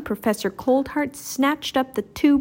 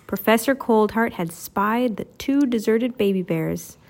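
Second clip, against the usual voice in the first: usual speaking voice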